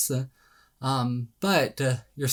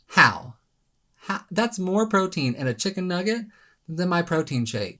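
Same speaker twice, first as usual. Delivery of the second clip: retold disbelief; 5 semitones higher than usual. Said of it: "that I'm like".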